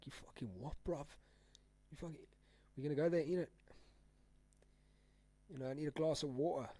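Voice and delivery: soft, mock London slang; British slang accent. Streamer quotes UK slang for chat, mild mock British accent. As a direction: lightly imitate a British lad. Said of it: "the terminology that the U K people say".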